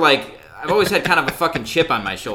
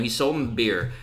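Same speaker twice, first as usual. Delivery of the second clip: normal talking voice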